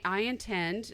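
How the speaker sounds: ordinary delivery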